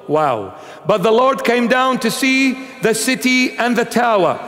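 normal talking voice

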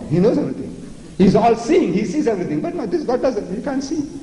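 ordinary talk

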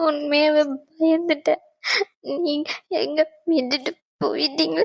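tearful, sobbing through the words; choked-up confession to her lover; crying. Acted. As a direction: cry out how scared you were. She cries after being left.

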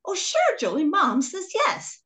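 put-on high, chirpy American accent on the phone; an English girl doing American for her friend Julie. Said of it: "talking to my mother in my English accent".

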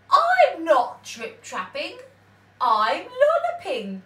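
a rabbit proudly setting it straight; indignant rabbit. A narrator's reading. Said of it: "said a creature".

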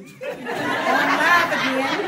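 laughing through the husband's line, slow and loud; laughing quote. Retold or imitated. Wife retelling husband's teasing about Jed's live, laughing.

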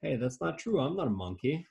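soft mock objection; hushed indignant aside; protesting aside. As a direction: drop the volume to deny it. Reader's protesting aside in book.

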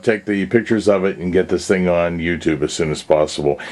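ordinary delivery

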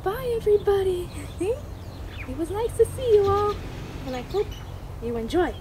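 high, loud, cheery dog voice; voicing the dog. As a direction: chirp a goodbye on the dog's behalf. Saying goodbye for the dog.

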